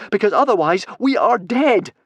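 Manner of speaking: high, loud gasp of panic. A narrator's reading. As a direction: gasp it out, building to a cry. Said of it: "gasped Fishlegs".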